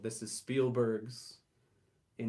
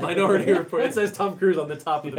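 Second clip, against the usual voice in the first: high, loud protest over laughter; loud self-correction; 7 semitones higher, 12 dB louder than usual. Real, not acted. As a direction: defend your slip loudly. Corrects own slip over laughter.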